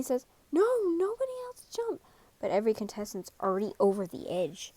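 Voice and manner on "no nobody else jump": much higher alarmed cry; frightened boy shouting a warning; panicked shout. Acted. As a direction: yell to stop everyone in panic. Gon yelling at contestants.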